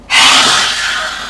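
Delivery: long open-mouthed breathy exhale; lion's breath exhale. Shown as a demonstration. Yoga teacher shows class the lion's breath, a forceful breathy exhale. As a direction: breathe out hard through the mouth like a lion. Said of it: "Add a sticking out of your tongue".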